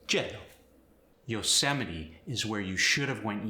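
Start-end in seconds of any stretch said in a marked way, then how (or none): 0.1-0.4 yellow said as jello, J sound; Spanish accent. Shown as a demonstration. Teacher showing Y to J, Spanish accent. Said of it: "Y sound gets changed to the J sound".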